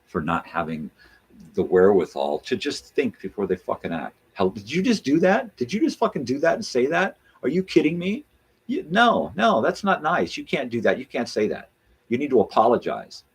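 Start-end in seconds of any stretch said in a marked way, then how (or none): 4.3-13.2 mock shaming. Shaming a rude stranger in public, high outraged scold.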